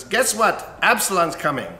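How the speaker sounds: father's mock-excited announcement; excited news. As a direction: burst in with the big news. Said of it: "who says".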